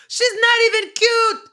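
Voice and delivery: imitating a loud, high-pitched scoff; mock dismissive outburst. Voices the owner dismissing the winner.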